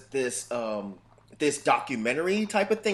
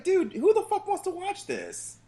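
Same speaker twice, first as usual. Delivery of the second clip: high laughing scoff at the show; laughing disbelief. Real, not acted. Mocking the cooking show, high laughing disbelief. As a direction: laugh it out, incredulous.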